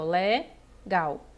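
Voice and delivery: "legal" in two beats, le, then gal; separação silábica. Shown as a demonstration. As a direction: draw out "le", pause, then "gal". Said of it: "a correta divisão silábica". (legal em duas sílabas, devagar)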